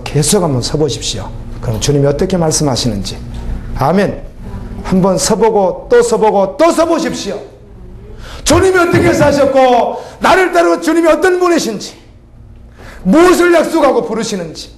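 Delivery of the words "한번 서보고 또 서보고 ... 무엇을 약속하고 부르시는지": rising to a high, drawn call; impassioned preacher's climb; fervent preaching. Acted. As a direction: build the exhortation to a peak. Preacher building an exhortation.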